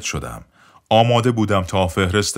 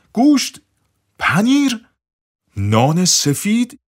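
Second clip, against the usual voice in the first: "گوشت، پنیر، نان سفید؟" each word much higher and louder, slow, rising in shock; stunned disbelief; 13 semitones higher, 5 dB louder, slower than usual. Retold or imitated. Narrator echoing the doctor's greasy order in disbelief. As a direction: repeat the order back in astonishment, "سفید" drawn out rising. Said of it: "نزدیک بود از تعجب شاخ در بیاورم".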